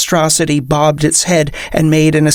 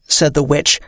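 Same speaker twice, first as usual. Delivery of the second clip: ordinary delivery, nothing marked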